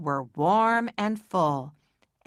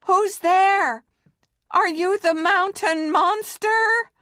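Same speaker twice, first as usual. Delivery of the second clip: much higher, louder scared call; trembling call; 12 semitones higher, 5 dB louder than usual. Acted. Maxwell calling out to the shape, scared. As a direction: call into the dark, trying to sound brave. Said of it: "voice trembled as he called out".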